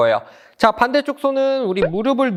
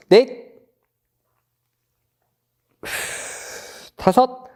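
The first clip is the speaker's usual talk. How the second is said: barking gym coach; 2 semitones higher, much slower than usual. Acted. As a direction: call each count like a gym coach. Coach counting held stretch. (헬스 코치처럼 크게 구령)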